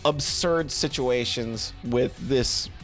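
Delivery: normal talking voice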